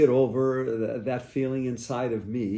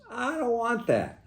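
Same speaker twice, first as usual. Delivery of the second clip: higher voice for the mother, curt no; mother's flat refusal; 11 semitones higher than usual. Retold or imitated. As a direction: imitate mom turning the offer down. Mother refusing, retold by son.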